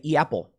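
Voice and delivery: "apple" opened with an "ee-a" glide, as "e apple"; Chicago accent vowel. Shown as a demonstration. Recalling his old accent, "apple" said as "e apple". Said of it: "I would say".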